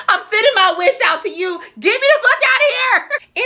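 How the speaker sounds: high-pitched, hammed-up begging; desperate plea. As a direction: plead to escape, laughter creeping in. Acted desperate prayer to escape.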